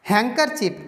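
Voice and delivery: हैंकरचीफ as "hang-ker-chip", no d; silent d. Shown as a demonstration. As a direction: model हैंकरचीफ with the d left out. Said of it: "हैंडकरचीफ नहीं बोलते". (हैंकरचीफ, ड की आवाज़ के बिना)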